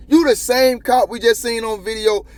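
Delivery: high-pitched, heated confrontation; indignant rebuke. Real, not acted. Host confronting a cop over tasing a man, indignant. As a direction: lay into him in rising outrage.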